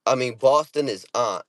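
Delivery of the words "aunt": "aunt" said as "ahnt"; ahnt pronunciation. Shown as a demonstration. Correcting himself on Boston's version, "aunt" said as "ahnt". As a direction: say "aunt" with an open ah vowel. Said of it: "how you say it".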